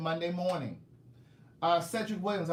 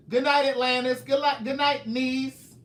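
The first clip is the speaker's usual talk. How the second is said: louder, slow sign-off voice; imitating herself; 6 semitones higher, 7 dB louder than usual. Imitating how fans hear her sign-off, loud and slow. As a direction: draw out a booming goodnight. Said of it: "like somebody doing like this".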